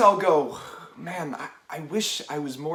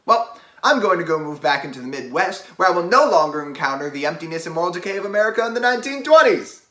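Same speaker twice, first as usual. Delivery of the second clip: loud rushed wrap-up; 10 dB louder than usual. Acted. Nick's loud closing speech.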